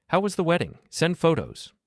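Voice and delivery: breezy, high and bright demand; casually brushing past the wedding; entitled. A narrator's reading. Mother's entitled text voiced.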